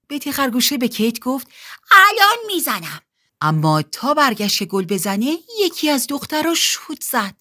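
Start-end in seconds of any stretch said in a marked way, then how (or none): 1.9-3.0 high, loud call back; shouted reply. Acted. Betty calls back to Kate mid-game, high excited shout. Answers shouted order during match.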